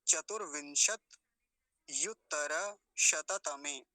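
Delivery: slow, broken into parts; deliberate teacher's pronunciation; step-by-step demonstration. Shown as a demonstration. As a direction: model each piece separately. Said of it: "फिर से देखिए".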